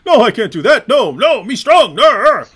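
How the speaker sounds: laughing mock caveman refusal; mock dumb character. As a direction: refuse like a dim strongman. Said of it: "This is a character".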